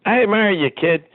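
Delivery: a hint of the owner's New York accent. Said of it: "in his accent".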